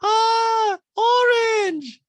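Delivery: very high, loud, slow squeal; imitating Diana in an orange outfit; mock Diana. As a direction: squeal it at yourself. Imitating Diana admiring her outfit.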